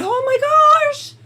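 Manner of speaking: high, loud burst of relief; retold overjoyed oh my gosh; relieved gasp. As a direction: exclaim it like a weight just lifted. Retold relief at court news.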